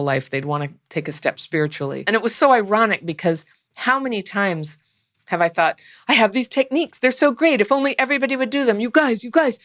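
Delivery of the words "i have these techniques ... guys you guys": high, excited pleading about her techniques; eager urging. Retold or imitated. Retelling her old self begging people to try techniques, excitedly. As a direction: gush at everyone to try it.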